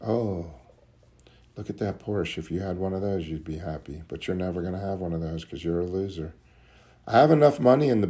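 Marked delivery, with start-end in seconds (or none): 0.1-6.3 hushed, coaxing voice of his own mind; tempting inner voice. Retold or imitated. His mind tempting him with a Porsche, hushed and coaxing. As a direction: lure him softly toward the Porsche. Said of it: "I could hear my mind".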